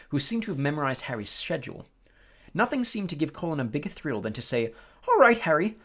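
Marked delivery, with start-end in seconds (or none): 5.1-5.8 put-on eager boy voice. Colin eagerly greeting Harry.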